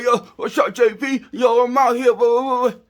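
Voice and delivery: hammed-up impression of a toothless dude calling out; mocking a guy with bad teeth. Mocking men with bad teeth, a loud hammed-up greeting. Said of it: "so you gotta talk like this right here".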